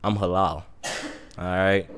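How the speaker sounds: low, soft, final no; understated firm refusal; quiet refusal. Retold or imitated. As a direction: shut it down quietly, pitched low. Acts out firmly refusing friends.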